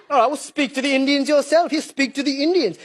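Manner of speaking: ordinary talk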